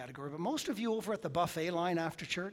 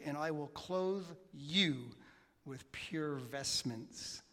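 slow tender reading; 4 dB quieter, much slower than usual. Acted. Reading scripture slowly.

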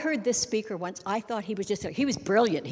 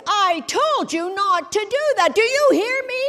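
parent yelling at a child in a hammed-up roar; really loud voice; 10 semitones higher, 6 dB louder than usual. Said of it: "we say in a really loud voice".

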